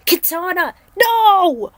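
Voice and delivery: high, loud cry of alarm; anguished cry. Acted. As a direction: scream her name as she falls. Katana struck by lightning.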